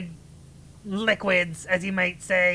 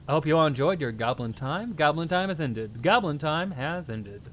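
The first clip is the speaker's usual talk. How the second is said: solemn sign-off voice; 5 semitones lower than usual. Acted. Streamer formally ending goblin time, lower and solemn. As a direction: close the show like a grave herald. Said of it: "talk in a weird voice".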